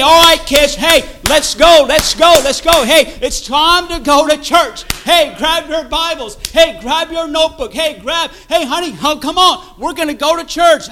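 put-on busy parent calling out to the kids before church; parent rounding up kids. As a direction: herd the family out the door for church.

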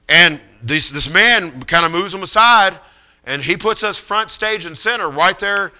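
ordinary delivery

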